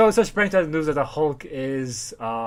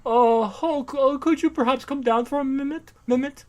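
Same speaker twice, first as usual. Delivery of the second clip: high, overly polite socialite request; mock rich people; 10 semitones higher, 3 dB louder than usual. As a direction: coax politely in a put-on society voice. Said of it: "they're like".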